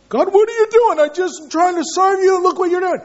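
high, loud, anguished complaint at God; bitter complaint to God. Pretend. A suffering believer shouts his bitter complaint at God. Bitter prayer voiced in sermon.

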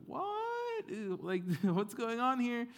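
very high, startled father; hammed-up squeal of confusion; mock bewilderment. Isaac discovers he was tricked, hammed-up high shock.

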